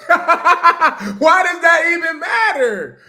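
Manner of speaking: high, loud, slow laughing outcry; real laughing exasperation; laughing shout. Real, not acted. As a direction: burst out laughing at the question. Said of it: "laughing exasperated outburst".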